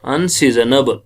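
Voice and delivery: normal talking voice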